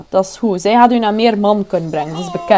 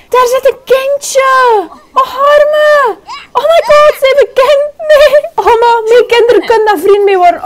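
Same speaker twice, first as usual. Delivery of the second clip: high cooing; 13 semitones higher, 5 dB louder than usual. Real, not acted. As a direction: coo over the little one. Cooing over the baby.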